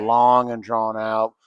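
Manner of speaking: very slow Texas drawl; long, drawn-out Texas accent. Shown as a demonstration. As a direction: stretch it out like a Texan. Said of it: "kinda more just, like".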